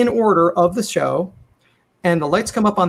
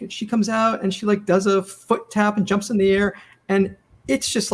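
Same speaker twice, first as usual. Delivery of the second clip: ordinary delivery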